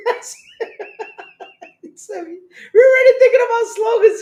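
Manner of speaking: high, loud, laughing through the words; real cracking up while trying to talk; helpless laughter. Real, not acted. Cracking up at own joke.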